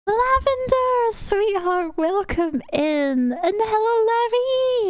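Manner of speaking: high, slow sugary welcome; slow, high, cooing hello; sweet greeting. Real, not acted. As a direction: coo the welcome to a friend. Greeting new viewer sweetly.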